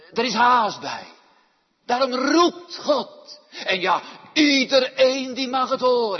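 loud, high, urgent proclamation; urgent preaching. Real, not acted. Preacher urges that God calls everyone, preaching loudly. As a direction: sound the alarm from the pulpit.

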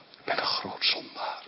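hushed, voiceless admission of guilt; whispered confession. Acted. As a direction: breathe it out like a confession. Thief's confession voiced by preacher. (gefluisterde schuldbekentenis)

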